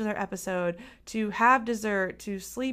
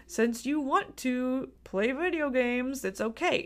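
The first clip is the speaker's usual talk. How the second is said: indulgent mom; 5 semitones higher than usual. Acted. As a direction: play a pushover parent humoring a child. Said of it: "the mom wouldn't be like".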